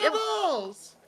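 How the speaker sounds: put-on high, loud child calling a cat; excited kid. Kid sees the lost cat, high excited call. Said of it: "And he goes".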